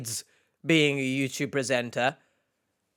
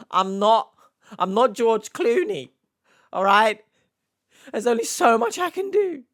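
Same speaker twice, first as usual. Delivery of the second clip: raised, exasperated protest; louder, higher, fed up; 6 semitones higher, 4 dB louder than usual. Real, not acted. Exasperated reply to commenter.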